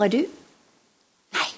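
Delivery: ordinary delivery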